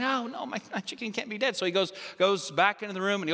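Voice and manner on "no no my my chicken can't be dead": high, stammering mock protest; acted denial. Woman refusing vet's verdict on chicken, stammered denial. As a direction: plead softly in a raised voice. Owner still in denial in joke.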